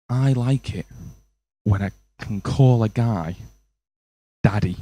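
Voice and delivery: low, soft, slow confession; mock seductive date. Tinder date tells the comic her fantasy, low mock-seductive voice. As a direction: imitate a date's intimate reveal, hushed and drawn out.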